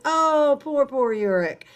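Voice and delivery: high sing-song Oh, then mock pity. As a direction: make fun of the corpse with fake sorrow. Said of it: "She's making fun of it".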